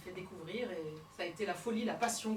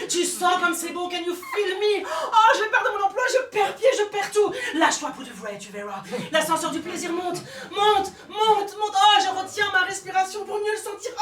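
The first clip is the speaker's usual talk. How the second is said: much louder and higher, shouting; hammed-up orgasmic climax; over-the-top passion, higher and louder; ecstatic; 10 semitones higher, 13 dB louder than usual. Mock ecstasy peaks.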